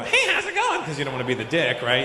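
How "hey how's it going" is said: very high-pitched mock-cheery voice; overfriendly greeter. Guy wrongly greeting a bouncer, overfriendly. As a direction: hail them in a silly high voice. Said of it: "we don't walk up and go".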